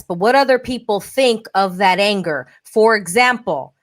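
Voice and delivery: ordinary talk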